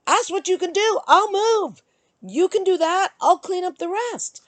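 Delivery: high-pitched, heated retort; retold angry outburst. Woman to ex who did not help, retold angry outburst. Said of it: "but I was screaming".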